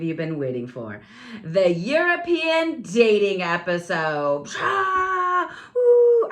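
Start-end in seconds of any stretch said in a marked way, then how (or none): 1.4-4.5 slow, grand announcer read; slow showbiz announcer voice; dramatic title announcement. Real, not acted. 4.6-6.3 high, loud imitated crowd whoop; hammed-up crowd woo noises; mock crowd cheer. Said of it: "I still gotta get those crowd noises".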